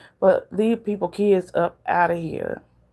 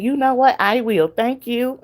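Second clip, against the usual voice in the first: imitating the lady; 7 semitones higher than usual. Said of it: "She said".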